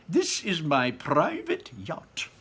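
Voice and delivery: high, slow proud showman; beaming with pleasure. A narrator's reading. Wonka proudly presents his yacht, high and beaming. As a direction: show it off, beaming. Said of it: "cried Mister Wonka, beaming with pleasure".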